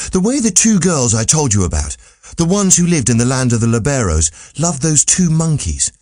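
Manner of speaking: lightly voiced astonished youth; wondering young Candide. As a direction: Candide marvelling at a strange tale.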